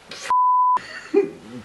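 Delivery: very high goofy laughing noise; mock flailing noise. Reviewer imitates Podrick on a horse, high goofy noise. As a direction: squeal like a hapless rider.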